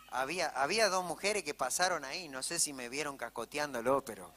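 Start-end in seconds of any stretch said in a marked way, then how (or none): none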